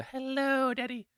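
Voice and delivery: very high, squeaky greeting; high cartoon voice at normal volume; cartoon character imitation. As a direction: squeak it like a tiny cartoon creature. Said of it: "This new season with the".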